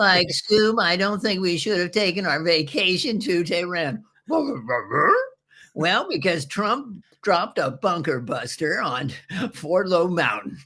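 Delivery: high, slow cartoon slacker voice; high, slow, scared Shaggy cartoon voice. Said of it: "do sort of Scooby-Doo and Shaggy in Tehran".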